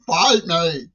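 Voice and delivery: loud, high-pitched imitation of a scream; mock scream.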